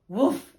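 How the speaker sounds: short, loud bark; dog's woof. A narrator's reading. Dog after the thieves are caught, loud woof. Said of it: "said the dog".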